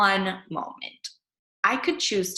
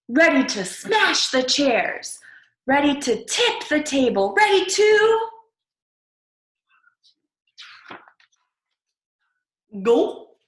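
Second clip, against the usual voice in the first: louder and higher each phrase, roaring at the end; monster build-up; 6 semitones higher, 7 dB louder than usual. Acted. Kid turning into veggie monster, building to a roar.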